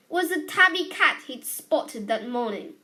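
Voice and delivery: plain reading voice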